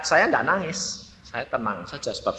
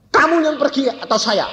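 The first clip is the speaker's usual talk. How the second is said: high-pitched mock angry demand; acted quarrel; 10 semitones higher than usual. Parent arguing over who leaves, mock angry demand. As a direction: snap the challenge out high.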